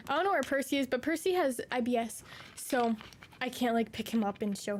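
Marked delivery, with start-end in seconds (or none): none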